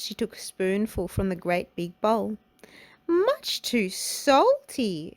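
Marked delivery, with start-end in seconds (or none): 3.1-5.1 slow, high cry of disgust. A narrator's reading. Goldilocks tasting the great big bowl, disgusted. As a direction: draw the words out in distaste. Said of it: "she cried in disgust".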